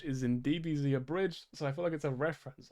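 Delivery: usual speaking voice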